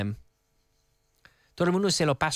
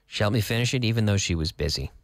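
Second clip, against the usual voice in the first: quick full-sentence model; 6 semitones lower, faster than usual. Shown as a demonstration. Teacher repeats sentence at speed.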